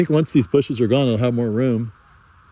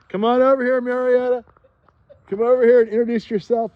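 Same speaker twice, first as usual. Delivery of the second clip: calling out; 10 semitones higher, 3 dB louder than usual. Real, not acted. Calling her over across garden.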